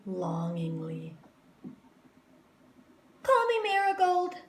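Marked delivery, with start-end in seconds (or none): 3.2-4.4 put-on eager girl. A narrator's reading. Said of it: "said Jo".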